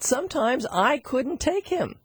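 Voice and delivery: usual speaking voice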